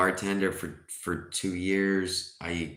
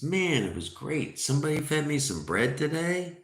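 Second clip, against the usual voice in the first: higher-pitched, playfully delighted duck; happy duck; 8 semitones higher than usual. Acted. Second duck tells the bartender about his day, delighted. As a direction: gush lightly, as a contented joke character.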